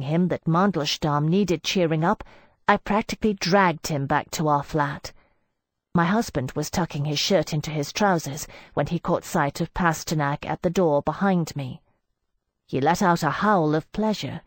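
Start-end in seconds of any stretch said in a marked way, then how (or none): none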